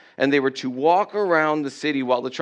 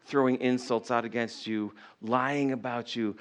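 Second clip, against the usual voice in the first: normal talking voice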